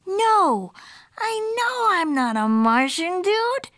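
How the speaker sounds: high, drawn-out cartoon voice; protesting kitty boy. A narrator's reading. Skipito correcting the Martian, protesting. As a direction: insist on it, swooping high.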